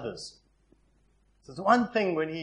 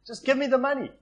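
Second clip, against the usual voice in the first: high, insolent son demanding his share; a son brushing off his father, higher and louder; brazen demand; 9 semitones higher, 4 dB louder than usual. Retold or imitated. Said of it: "It was like saying".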